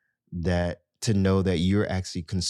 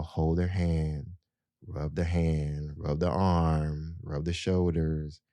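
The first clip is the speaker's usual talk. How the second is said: ordinary delivery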